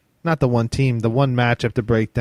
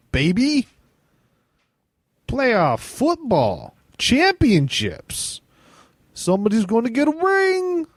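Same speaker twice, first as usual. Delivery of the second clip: hyped up; 10 semitones higher than usual. Acted. Hyping the championship weekend.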